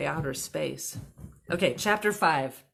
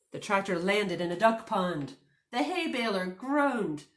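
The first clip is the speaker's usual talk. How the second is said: ordinary delivery, nothing marked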